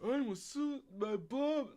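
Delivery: high-pitched mock game-character voice; GTA NPC voice. Streamer mocking the suspect's claim, high NPC voice. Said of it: "bro sound like a straight G T A N P C".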